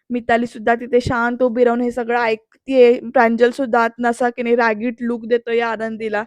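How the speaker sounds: ordinary delivery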